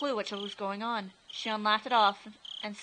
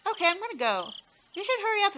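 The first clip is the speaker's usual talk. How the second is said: put-on girl voice; 11 semitones higher, 5 dB louder than usual. Shion voiced by narrator.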